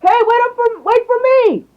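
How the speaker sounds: put-on very high, loud kid's scream; screaming to friends. A narrator's reading. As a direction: shriek after friends to hold up. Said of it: "they would hear someone screaming".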